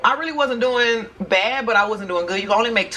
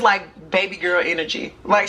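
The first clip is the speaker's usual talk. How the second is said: ordinary talk, nothing marked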